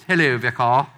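loud, very English posh voice. Acted. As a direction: put on a posh English accent. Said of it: "in that very English posh voice".

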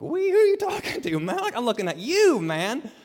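high, fast, incredulous outburst; loud, freaked-out churchgoer; mock bewilderment. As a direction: squeal it in comic disbelief. Acting out a baffled churchgoer's reaction.

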